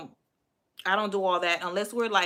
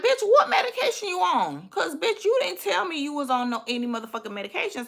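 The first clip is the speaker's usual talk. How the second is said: high-pitched mock-indignant demand, easing down; indignant inner outburst; 8 semitones higher than usual. Woman silently questioning her date about his medication, indignant. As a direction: act out an incredulous inner rant.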